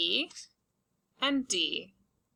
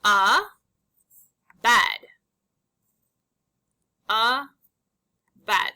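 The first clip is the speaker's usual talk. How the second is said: emphatic; 8 dB louder, much slower than usual. Shown as a demonstration. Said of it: "Our next two words are".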